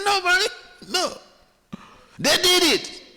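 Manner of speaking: put-on high child's voice pushing blame away; denying child. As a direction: whine it like a kid shifting blame. Child blames others to mother.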